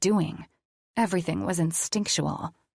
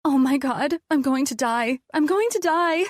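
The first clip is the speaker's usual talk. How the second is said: high, loud, climbing terror; terrified panic; 8 semitones higher, 4 dB louder than usual. A narrator's reading. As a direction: panic harder with each repeat. Panicking patient.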